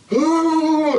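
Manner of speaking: high, loud anguished cry; imitating her panic on the phone; panicked retold wail. Calling her daughter shaken after the shooting, high panicked wail. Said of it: "it was more like".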